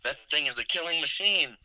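high, panicked warning; alarmed quote. Retold or imitated. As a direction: sound the alarm about the kid. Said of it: "he says".

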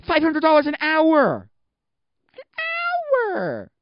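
high, drawn-out yell of disbelief; incredulous shout. Real, not acted. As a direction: build to a screeched, stretched-out HOUR. Escalating outburst at the rates.

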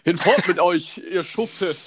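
put-on high, slow, booming old-time king; archaic royal proclamation. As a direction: proclaim it like a pompous medieval herald. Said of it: "singt dabei noch komische Lieder über Könige".

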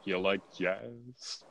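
low, slow, drawn-out movie-line impression; Jerry Seinfeld. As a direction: drop down low and stretch out the question. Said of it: "where Jerry Seinfeld just says".

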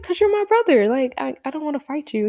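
mildly pleading, starting high then settling; high-pitched explanation easing down; retold protest. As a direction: plead lightly as a reluctant kid. Child pleads not to fight.

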